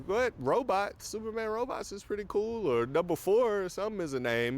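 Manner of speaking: high, incredulous voice; squeaky mock disbelief; Superman voice. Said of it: "and he goes".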